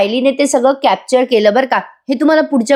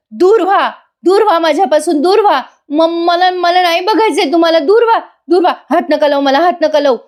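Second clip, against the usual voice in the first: panicked yelling; 8 semitones higher than usual. Retold or imitated. Frightened Suman fending off Nagraj, acted panicked yelling. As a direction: imitate a frightened woman crying out. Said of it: "आता सुमनताई मोठ्याने ओरडतात".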